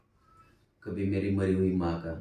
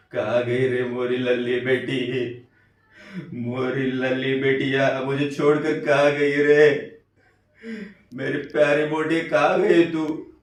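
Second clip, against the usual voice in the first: loud, drawn-out keening, rising higher; mother's wailing lament; 3 semitones higher, 6 dB louder than usual. A narrator's reading. Mother mourning her lost daughter, wailing lament. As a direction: wail in a slow sing-song, building in pitch and force. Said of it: "बैन करते हुए मेरी अम्मा की आवाज़".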